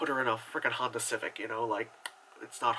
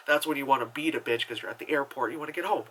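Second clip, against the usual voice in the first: ordinary delivery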